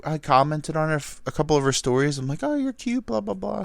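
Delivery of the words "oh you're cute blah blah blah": high, soft sweet-talk voice; mock flirty compliment. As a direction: retell your flirting in a high, light voice. Retelling his own flirty compliment.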